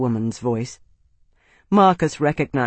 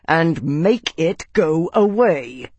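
slow words forced through clenched teeth; 2 semitones higher, 3 dB louder, slower than usual. A narrator's reading. Arnas demanding Lady Aquitaine's help, through clenched teeth. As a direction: hold the anger in behind your teeth. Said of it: "his voice coming through clenched teeth".

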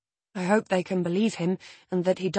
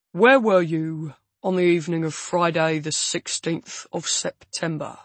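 flat heavy voice; 4 dB louder than usual. A narrator's reading. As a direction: ask it flatly, weighed down. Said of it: "the stranger asks, flatly".

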